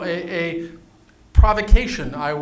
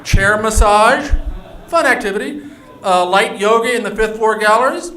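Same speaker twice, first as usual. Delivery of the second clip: loud, higher, questioning sales pitch; mock-enthusiastic announcing; 5 semitones higher, 6 dB louder than usual. Real, not acted. Host reading the fun activities to the audience, mock-enthusiastic. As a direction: announce each activity high and loud, with tongue in cheek.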